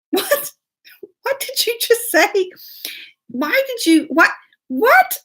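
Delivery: high-pitched, incredulous questioning; shocked disbelief. Retold or imitated. Mom reacting to what the child told her husband, high and aghast. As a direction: squeak out stunned questions in mock horror.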